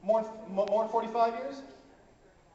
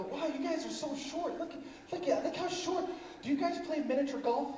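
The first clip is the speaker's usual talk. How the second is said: mock amazement; 6 semitones higher, 4 dB quieter than usual. Comic teasing an imagined short person, mock amazement. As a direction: coo it high, marveling with exaggerated awe.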